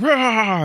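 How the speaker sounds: high, loud mock roar; hammed-up yell of agony; agonised roar. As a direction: roar as the blade goes in. Tom roars after stabbing himself.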